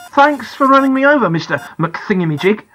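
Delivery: bitter, sarcastic outburst, pitched high; angry, churning inside. A narrator's reading. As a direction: let the indignation boil over. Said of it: "I'm so angry. I'm churning up inside".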